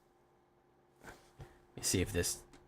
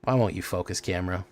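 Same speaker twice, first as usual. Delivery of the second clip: ordinary delivery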